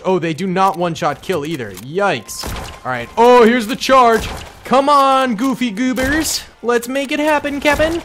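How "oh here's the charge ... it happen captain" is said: high, loud cheering of the charge; excited cheering. Real, not acted. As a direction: yell them forward as the charge starts.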